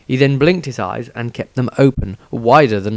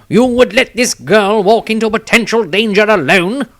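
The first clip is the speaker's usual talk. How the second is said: indignant halfling; 11 semitones higher, 5 dB louder than usual. Acted. Halfling Tobias objects.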